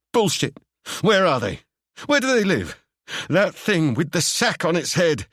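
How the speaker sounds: high, angry character shouts; angry outburst. Acted. Seb demanding answers from Ewan, high and angry. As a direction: hurl the questions at him.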